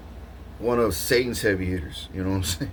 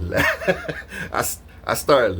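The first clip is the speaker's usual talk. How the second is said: laughing; 3 semitones higher than usual. Real, not acted. Laughs while telling.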